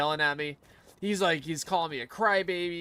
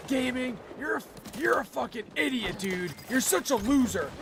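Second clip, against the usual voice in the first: much higher, mock screamed insults; imitating a screaming player; 9 semitones higher than usual. Teammate screaming into his microphone at me, mock scream. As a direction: shriek it like a raging kid on the mic. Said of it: "he's, like, screaming".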